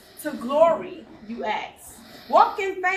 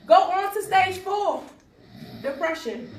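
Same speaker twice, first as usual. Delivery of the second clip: higher, louder declaimed heading; raised announcing voice; stage announcement; 4 semitones higher, 3 dB louder than usual. Acted. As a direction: call out the next stage to the room. Announces next stage in poem.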